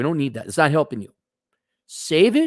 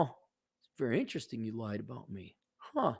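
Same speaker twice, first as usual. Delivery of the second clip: calm and quiet; 6 semitones lower, 14 dB quieter than usual. Shown as a demonstration. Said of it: "without getting agitated or annoyed".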